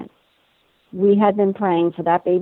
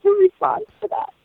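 incredulous squeak; 13 semitones higher than usual. Retold or imitated. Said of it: "I questioned her".

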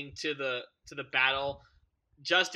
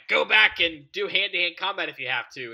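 louder, forceful order; imitating Picard; 3 semitones higher, 7 dB louder than usual. Picard ordering crew back to fight the Borg, imitated command. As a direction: give a firm mock command. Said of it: "And Picard's like".

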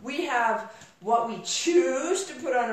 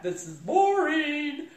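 slow, higher, drawn-out mock whine; bored complaint; 4 semitones higher, slower than usual. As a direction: imitate a bored back-seat passenger. Retelling husband's complaint from back seat.

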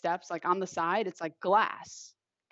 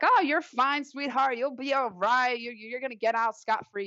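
comforting cellmates; 5 semitones higher than usual. Retold or imitated. Cellmates reassure the crying teen, a high comforting coo. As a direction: soothe the crying girl like a tough big sister.

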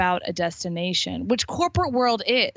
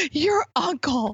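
high, drawn-out mom voice; indignant mom; 7 semitones higher, much slower than usual. Retold or imitated. Mom revealing who told her, exasperated. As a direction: stretch it out in mock alarm. Retold freaked-out mom naming source.

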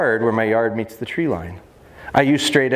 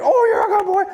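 very high, gushing praise; little girl voice. Shown as a demonstration. Trainer praising Tito for getting it, little girl voice. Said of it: "I sound like a little girl".

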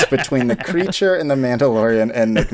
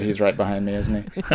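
hushed movie line; 2 semitones lower, 7 dB quieter than usual. Retold or imitated. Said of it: "I always love a good".

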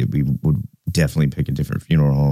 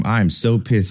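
ordinary talk

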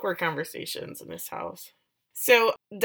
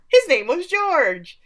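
wistful mother; 10 semitones higher, 7 dB louder, slower than usual. Retold or imitated. Millie's wistful answer, long pause.

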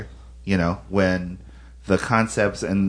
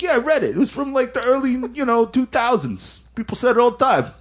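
know-it-all dude. Retold or imitated. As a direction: pitch it way up like a smug pizzeria guy. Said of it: "It's like".

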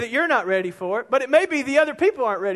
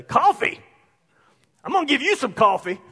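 sarcastic indignation; 4 semitones higher, 4 dB louder than usual. Retold or imitated. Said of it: "That's what I wanted to say".

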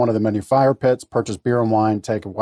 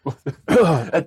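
harsh real coughs; coughing fit; 5 semitones higher than usual. Real, not acted. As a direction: cough hard, clearing the throat. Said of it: "Coffee went down the wrong pipe".

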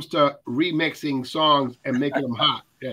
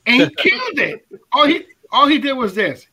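bursting out, much higher and louder, laughing; laughing excitement; 9 semitones higher, 7 dB louder than usual. Real, not acted. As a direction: cannot hold back the thrill at the remix. Excited about the remix.

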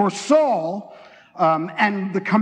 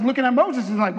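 ordinary delivery

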